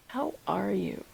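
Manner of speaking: soft, low-key check-in; low-key greeting. Shown as a demonstration. Actor showing a second reading of the line, soft and low-key. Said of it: "interpret this line in multiple ways".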